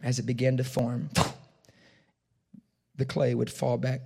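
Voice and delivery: normal talking voice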